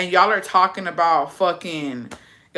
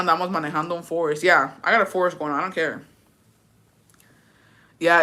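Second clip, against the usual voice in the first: normal talking voice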